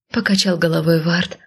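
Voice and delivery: usual speaking voice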